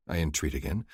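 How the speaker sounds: usual speaking voice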